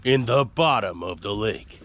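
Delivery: slow, deep mock-epic intoning; deepest voice ever. Shown as a demonstration. Mom finishing the title over a child's protest, deep voice. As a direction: draw it out in a put-on deep voice. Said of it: "in the deepest voice ever".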